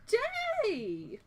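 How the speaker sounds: high, slow, gushing sales pitch; hammed-up merchant voice; old dude pushing straw hats; flamboyant shopkeeper.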